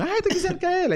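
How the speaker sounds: squealing high and loud; excited fan. Retold or imitated. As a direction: shriek it like a starstruck fan. Excited fan recognizing him.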